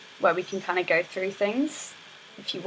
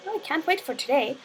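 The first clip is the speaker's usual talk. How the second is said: higher-pitched eager hero; game hero narration; 6 semitones higher, 4 dB quieter than usual. Acted. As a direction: lightly act out an excited character. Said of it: "He's got some narration".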